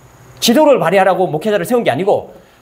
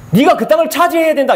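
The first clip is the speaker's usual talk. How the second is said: raised pitch, mock commanding God; mock wrong command; 6 semitones higher than usual. Preacher voicing God's words as wrongly heard, mock command. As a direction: voice the misreading as a pushy order. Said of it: "이렇게 말하지 않았다는 거예요".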